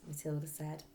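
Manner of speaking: ordinary delivery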